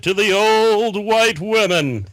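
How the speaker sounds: high, loud, drawn-out speech voice; mock orator. Topping the bad impression for friends, booming mock oratory. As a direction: proclaim it like a hammed-up speechmaker.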